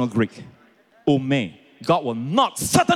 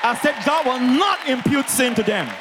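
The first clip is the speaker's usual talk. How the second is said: emphatic proclamation; 8 semitones higher than usual. Real, not acted. Preacher hammers home his central claim.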